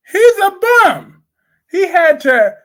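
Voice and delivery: exasperated outburst at a soap character; loud scorn. Real, not acted. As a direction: shout the verdict like it's obvious. Insulting Johnny, agreeing loudly.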